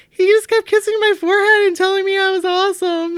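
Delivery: high mock wail, retold; crying. Woman retells friend crying over forehead kisses, high mock-tearful voice. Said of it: "she came out crying".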